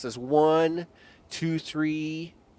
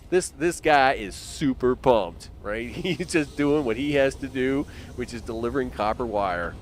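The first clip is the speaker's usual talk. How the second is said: usual speaking voice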